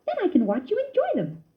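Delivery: high bright mouse voice; a cousin keen to watch him eat; lightly voiced happy mouse; Ced explaining cheerfully. A narrator's reading. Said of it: "Ced explained".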